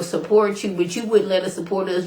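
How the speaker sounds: usual speaking voice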